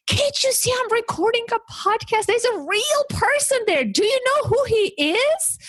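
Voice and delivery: losing it. Retold or imitated. Mother scolding her daughter for interrupting the podcast, high exasperated scolding. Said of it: "And I just lost it".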